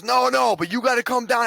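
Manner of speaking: high, mildly mocking cop; voicing the cop. Streamer quoting the cop's order, high and lightly mocked.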